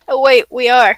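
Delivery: normal talking voice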